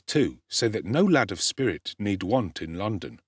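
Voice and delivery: usual speaking voice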